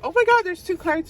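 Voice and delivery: real startled exclamation; surprised. Real, not acted. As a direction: caught off guard by a discovery. Finds a second card.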